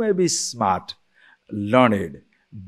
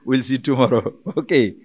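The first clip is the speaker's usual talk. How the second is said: usual speaking voice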